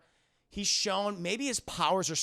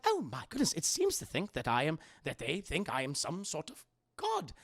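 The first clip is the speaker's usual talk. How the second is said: fussy protocol droid voice; C-3PO; 2 semitones lower than usual. Acted. Droid realizing they think him a god, flustered. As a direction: play a flustered, proper robot.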